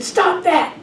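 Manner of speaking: put-on high, sharp kid's protest; a frightened boy snapping at the echo; scared child's yell. Lost boy yelling at the echo, high and scared. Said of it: "And he said".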